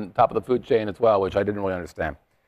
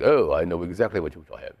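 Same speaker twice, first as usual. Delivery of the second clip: lower, confident waiter's voice, just a hint; a sommelier who already knows the answer; lightly voiced sommelier; 7 semitones lower, 4 dB quieter than usual. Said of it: "he's like".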